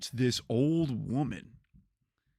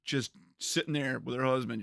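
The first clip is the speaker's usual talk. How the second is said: normal talking voice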